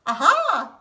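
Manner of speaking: high, loud eureka cry; a sudden cry of discovery; triumphant aha. Acted. Big Anthony finds the pot working, triumphant high exclamation. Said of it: "said Big Anthony".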